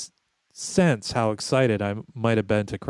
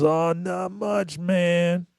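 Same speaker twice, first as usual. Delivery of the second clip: put-on higher, slow, lazy drawl of a stoner kid; slow stoner dude voice; 7 semitones higher, much slower than usual. Telling a friend how the stoner kid greeted him, lazy high drawl. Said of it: "He goes".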